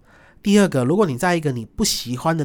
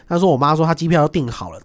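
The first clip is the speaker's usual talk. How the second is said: ordinary delivery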